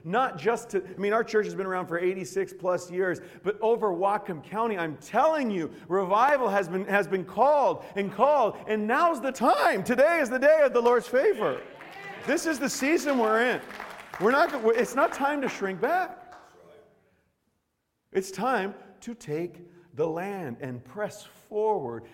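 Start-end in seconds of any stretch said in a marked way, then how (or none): 3.4-16.2 loud, high impassioned preaching; full-force preaching. Acted. 18.1-22.0 slow declaration. Acted. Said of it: "slowed declaration".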